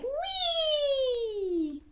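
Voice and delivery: high long sliding whee; playful whee. Pretend. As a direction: ride down the slide with glee. Sliding animals voiced.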